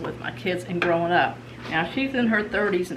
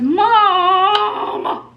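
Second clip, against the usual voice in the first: high, louder drawn-out Mom; imitating whiny teen; 11 semitones higher, 8 dB louder than usual. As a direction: whine it like an exasperated daughter. Daughter whining at mom.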